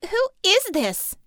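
high, loud, slow demand; angry demand. A narrator's reading. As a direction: seethe and demand to know. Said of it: "Harmony demanded".

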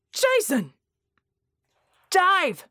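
high loud scream of warning. Acted. Red screaming at his team under fire. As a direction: scream for them to get down. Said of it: "Red screamed".

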